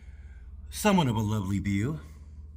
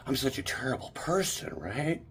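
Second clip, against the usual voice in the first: unvoiced mock whisper; 2 semitones higher, 6 dB quieter than usual. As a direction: breathe it as a sarcastic aside. Mocking how security treats him.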